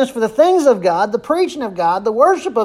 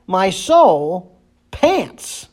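"My soul pants" read very slowly, leaning on "pants"; slow scripture reading; 3 semitones lower, much slower than usual. Shown as a demonstration. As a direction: draw out each word of the psalm. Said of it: "He repeats the thought in verse two".

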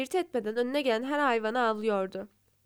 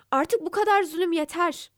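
higher, loud outburst of protest; hare shouting; 6 semitones higher, 4 dB louder than usual. A narrator's reading. Hare shouts at the lion's tyranny, loud and defiant. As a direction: shout it, fed up at last. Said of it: "diye bağırdı".

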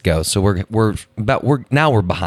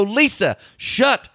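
high, slow mock exasperation; hammed-up fed-up husband, pitched way up; retold mad husband; 12 semitones higher, slower than usual. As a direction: act out being at the end of one's rope with the wife. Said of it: "I'm getting mad".

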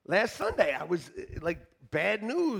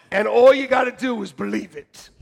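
loud, punching ALL; emphatic preacher shout; 7 dB louder than usual. Real, not acted. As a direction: preach it out to the room. Repeats line, first take shouted.

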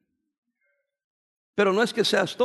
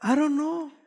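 high, laughing shrugged "I don't know"; mock clueless teen; 6 semitones higher, slower than usual. Preacher mimicking a teen's lame answer, laughing mock shrug. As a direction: toss it off like a clueless kid. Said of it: "qué dice el joven común".

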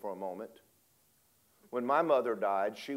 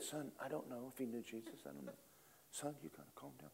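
hushed; 19 dB quieter than usual. Retold or imitated. Father hushing son at funeral.

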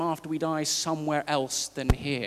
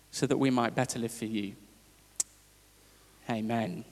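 prayer; 3 semitones lower than usual. Acted. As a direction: pray gently on behalf of the room. Leads an opening prayer.

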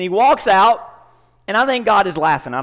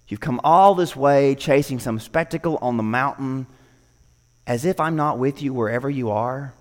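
lower, calm, tender reassurance; lightly voiced gentle God; 8 semitones lower than usual. God gently reassures tired Elijah, low and calm. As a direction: speak like a patient father.

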